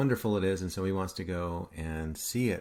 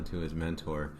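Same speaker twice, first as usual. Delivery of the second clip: normal talking voice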